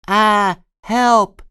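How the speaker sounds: high, loud cry for help; shouting for help. A narrator's reading. Baby sloth shouting for help as he falls. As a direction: yell it out as you fall. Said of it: "shouts Reginald".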